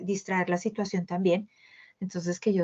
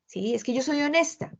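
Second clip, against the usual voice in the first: higher, louder mock of someone proud of being brutally honest; mock blunt honest person; 6 semitones higher, 4 dB louder than usual. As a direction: play the self-righteous one who hurts and calls it honesty. (imitando con burla a alguien que presume de honesto)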